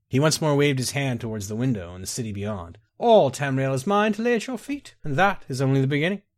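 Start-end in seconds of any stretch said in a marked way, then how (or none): 3.0-6.2 high, exultant promise of an empire; grandiose wooing. Acted. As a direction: offer the world with soaring pitch. Nightingale offers her the empire.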